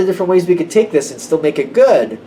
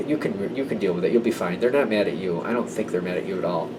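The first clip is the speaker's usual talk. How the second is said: ordinary delivery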